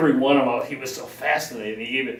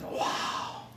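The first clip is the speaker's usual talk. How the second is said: awed whisper; 10 dB quieter than usual. Retold or imitated. Hushed awe at emeralds.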